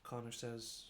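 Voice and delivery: ordinary delivery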